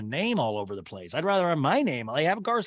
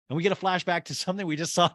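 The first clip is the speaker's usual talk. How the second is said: normal talking voice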